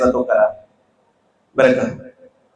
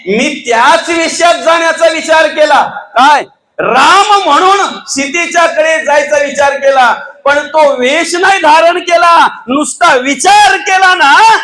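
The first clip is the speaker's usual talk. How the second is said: high, loud booming king's boast; slow, raised mocking boast; boastful Ravana; 10 semitones higher, 4 dB louder, slower than usual. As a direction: proclaim it like an arrogant demon king. Ravana boasting to Sita tale.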